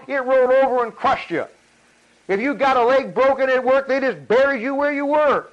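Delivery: high-pitched, insistent preacher's tirade; impassioned preaching. Real, not acted. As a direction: hammer each wrong home, pitched high.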